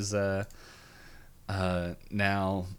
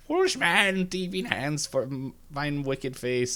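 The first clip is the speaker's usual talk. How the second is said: hand witch; 7 semitones higher, slower than usual. Retold or imitated. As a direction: croon a curse as a wicked witch. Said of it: "the hand witch, like, talks through the watch".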